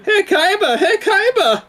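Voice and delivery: very high, loud cartoon guard call, laughing. Friend imitating the blimp guards calling Kaiba, in a high cartoon yell. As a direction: squeal it like a silly cartoon henchman. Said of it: "they talk exactly like that".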